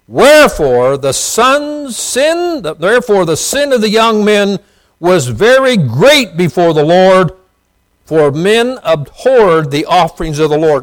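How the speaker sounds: booming preacher reading the Bible; scripture reading. Acted. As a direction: proclaim the verse to the congregation. Preacher reads scripture aloud.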